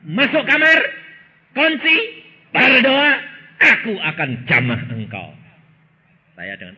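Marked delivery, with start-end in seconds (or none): 0.1-5.3 high, loud commanding call; voice of God commanding. Retold or imitated. Preacher voicing God's command to enter the room. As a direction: proclaim each word as God's order. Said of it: "Hari ketiga Tuhan berkata".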